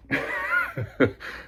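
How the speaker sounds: squeaky giggle at the dog. Real, not acted. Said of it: "I kinda go into my baby voice with her".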